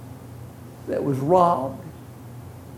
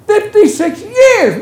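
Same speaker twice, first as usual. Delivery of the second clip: much higher, louder, slow cry of disbelief; astonished exclamation; 12 semitones higher, 5 dB louder, slower than usual. Acted. Preacher exclaiming to the congregation, astonished and loud. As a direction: throw the number up in amazement.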